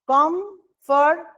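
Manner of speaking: কমফোর্টেবল slowly parted into four syllables. Shown as a demonstration. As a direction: pronounce কমফোর্টেবল piece by piece for learners. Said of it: "যেভাবে উচ্চারণ করব".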